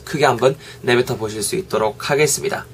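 ordinary delivery

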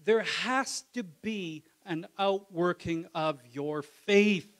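slow, loud, high solemn warning; imitating James. James warning the church that faith is dead, slow and loud. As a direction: drag it out like a stern preacher. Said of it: "James is like".

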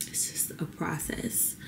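a slow, breathy whisper, almost to herself. Real, not acted. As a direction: let it slip out as a hushed whisper, slowly. Emotional, reassuring herself quietly.

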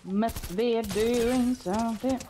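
slow drawn out. Pretend. Catchphrase drawn out.

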